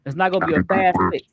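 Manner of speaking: glitchy robotic stream audio; robot voice from a lagging connection. Real, not acted. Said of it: "now you sound like a robot".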